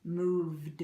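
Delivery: "Moved" ending in a voiced D. Shown as a demonstration. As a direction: say "Moved" with a buzzing D. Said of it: "Vibrations go with vibrations".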